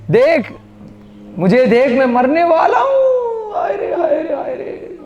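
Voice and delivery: high, hammed-up wail of a fake death; mock dying monkey. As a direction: ham it up like a monkey pretending to die. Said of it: "बंदर की acting". (नाटकीय ढंग से मरने का ढोंग करता बंदर)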